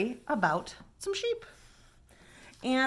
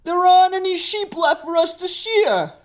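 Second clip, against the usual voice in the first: higher, louder wailing complaint; whiny complaint; 7 semitones higher, 6 dB louder than usual. Acted. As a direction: wail that the work is gone. Big Bob keeps complaining.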